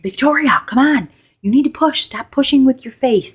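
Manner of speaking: high, loud urgent coaching mom. Retold or imitated. Mom urging her daughter to push in labor, high and loud.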